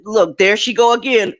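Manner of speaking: ordinary delivery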